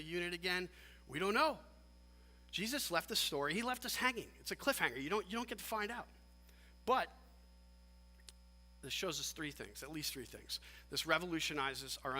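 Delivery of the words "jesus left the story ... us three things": ordinary talk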